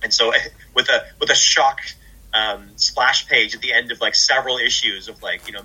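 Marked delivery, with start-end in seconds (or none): none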